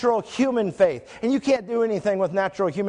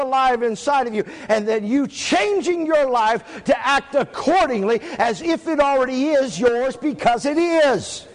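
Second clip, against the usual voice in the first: high, driving preacher's delivery; emphatic pulpit proclamation; preaching at full force; 5 semitones higher than usual. Real, not acted. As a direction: preach it out higher, slowing hard on the last phrase.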